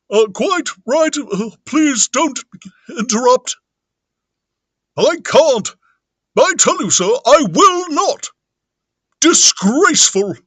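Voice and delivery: very silly, high, loud vicar voice; Vicar Bunting. Acted. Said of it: "That's a very silly voice".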